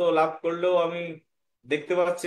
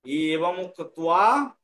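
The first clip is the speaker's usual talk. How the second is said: এবং উক্ত্বা stretched out with a lifted ending; slow recitation cue; 3 dB louder, much slower than usual. Shown as a demonstration. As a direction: draw out the opening for someone to follow. Teacher cues pupil to recite.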